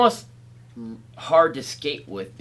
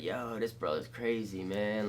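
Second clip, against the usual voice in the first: awed quiet remark; 5 semitones lower, 13 dB quieter than usual. Retold or imitated.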